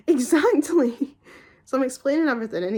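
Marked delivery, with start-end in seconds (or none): 0.1-1.2 emphatic vindication. Retold or imitated. Vindicated retort to doctor.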